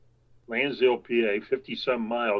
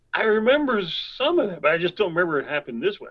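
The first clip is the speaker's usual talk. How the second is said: higher, louder imitation of a doubting friend; mock protest; 6 semitones higher, 3 dB louder, faster than usual. Friend disputing how the story happened, mock protest. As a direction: protest in a raised, quoting voice.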